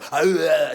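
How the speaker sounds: quick mock beast roar; monster growl. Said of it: "you hear the monster go".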